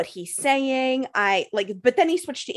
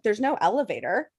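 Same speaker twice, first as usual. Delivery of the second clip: ordinary talk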